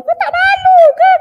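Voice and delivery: loud, very high-pitched scolding; exaggerated scandalised reproach; mock shaming. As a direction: shame them in a shrieky put-on voice.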